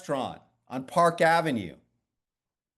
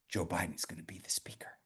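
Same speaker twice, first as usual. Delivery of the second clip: lowered, unvoiced secret whisper; 12 semitones lower, 13 dB quieter than usual. [name] confides the secret speaker.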